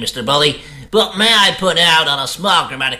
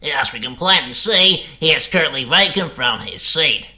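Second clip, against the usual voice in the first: ordinary delivery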